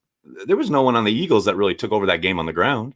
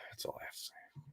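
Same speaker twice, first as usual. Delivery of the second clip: whisper; 29 dB quieter than usual. Real, not acted. Football team chant aside.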